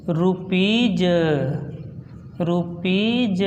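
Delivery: रुपीज ending in ज; modelled pronunciation. Shown as a demonstration. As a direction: teach रुपीज with final ज. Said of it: "इसको बोलेंगे".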